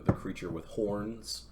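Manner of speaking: plain reading voice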